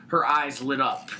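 usual speaking voice

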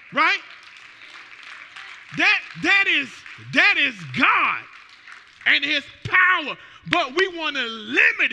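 loud, high shouted preaching; fiery preaching. Real, not acted. Pastor testifying healing to church, loud shouted preaching. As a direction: shout the praise to the rafters.

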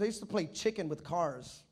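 usual speaking voice

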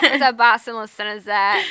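loud mock Boston twang, dropped R's; Boston accent. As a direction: shout it out in an overdone Boston accent. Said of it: "Such a bad Boston accent".

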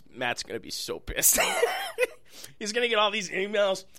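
ordinary talk

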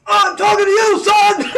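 drill sergeant shout. Retold or imitated. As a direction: yell it like a drill instructor. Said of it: "he goes".

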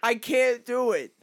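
laughing despair, rising; exasperated. Real, not acted. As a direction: wail it in amused defeat. Giving up on the accent game.

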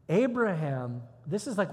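usual speaking voice

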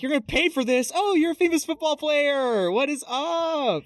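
high-pitched switch to starstruck greeting; George Lopez. Retold or imitated. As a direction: mimic a fan recognizing a star, stretching the last words. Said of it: "George Lopez is like".

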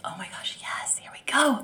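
soft unvoiced hush of excitement; excited whisper. Mother in labour whispering to herself, thrilled. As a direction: breathe it out, thrilled but hushed. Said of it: "and I'm like".

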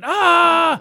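loud, high mock scream; Sam Rockwell's scream. Retelling the actor's surprise outburst, loud high scream. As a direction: burst into a sudden imitated scream. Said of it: "starts screaming".

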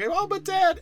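put-on very high, girlish whine; whiny daughter. Mermaid daughter pleading with her dad, squeaky whine. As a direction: squeak it like a pleading teen girl. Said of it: "And then she's like".

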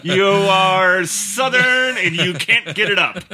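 slow, drawn-out game-show style announcement; mock announcer. Announcing a spin of the character wheel, mock announcer. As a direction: announce the wheel's result slowly, half laughing.

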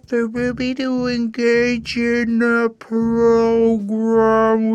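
high, drawn-out, sing-song teacher voicemail; mock a teacher talking down very slowly; patronizing teacher. Reviewer mimicking the teacher's voicemail to parents, patronizing.

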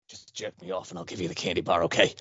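low, quick, hushed creep; creepy candy seller. Acted. Creep closing the candy deal, low sly voice. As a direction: hurry the deal along under the breath. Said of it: "This is Count Creepyhead candy bar".

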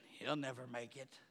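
imitating a scornful churchgoer, hushed; dismissive naysayer. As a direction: toss it off like a cynic writing someone off. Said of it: "Instead of saying".

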